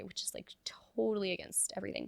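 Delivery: whispered aside. Real, not acted. Conspiratorial aside.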